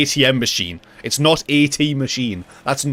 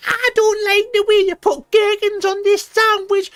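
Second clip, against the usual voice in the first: shrill-free but squeaky demanding customer; Karen voice. Retold or imitated. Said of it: "your little Karen haircut and go".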